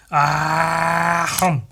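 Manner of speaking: drawn-out open-mouthed ahh into a chomp; chomping noise. Retold or imitated. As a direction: imitate a big bite, ahh then hum. Imitating a creature biting down.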